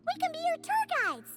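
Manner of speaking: high-pitched, eager cheer; volunteer like a delighted little sidekick; excited offer. Acted. Companion eagerly offers to guide.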